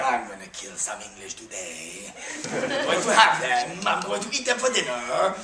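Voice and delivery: low mocking French accent with a laugh; hammed-up French soldier boasting he will eat the English; very strange French accent. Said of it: "Very strange French".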